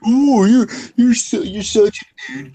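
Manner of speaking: high, loud mocking jeer; mock taunting. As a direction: imitate an over-the-top online heckler. Voicing the spam edits they expect.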